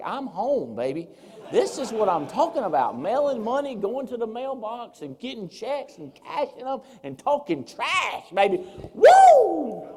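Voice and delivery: high, whooping excitement; hammed-up jubilant cheer. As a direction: crow with delight, building to a loud whoop. Acting out his past jubilation.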